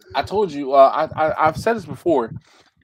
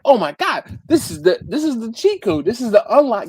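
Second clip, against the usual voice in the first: higher and louder, thrilled realization; excited discovery; 8 semitones higher, 3 dB louder than usual. Retold or imitated. Recalling finding the cheat code, excited. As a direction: sell it like you found a cheat code. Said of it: "it was like".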